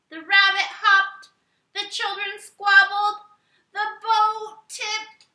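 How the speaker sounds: from lively to high, loud and breathless; building excitement. A narrator's reading. Story chaos builds to the tipping.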